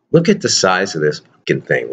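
usual speaking voice

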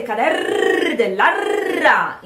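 "cader dell'ara" with long rolled Rs, high and loud; overdone double R. Shown as a demonstration. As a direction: roll every R far too long in "cader dell'ara". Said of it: "you're pronouncing a double R".

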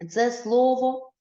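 ordinary talk

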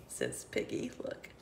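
ordinary delivery

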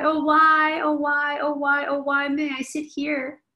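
a hint of a crying little child. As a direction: whimper like a sulking child. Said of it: "starts to cry".

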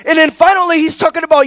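high, loud, fiery preaching; preaching at full force. Acted. Pastor rousing the congregation about Jesus, full-force preaching. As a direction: lift the room, preaching hard on each phrase.

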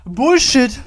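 loud furious yell of protest; real fury, very loud; angry shout. Real, not acted. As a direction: yell it at the screen. Dies in game.

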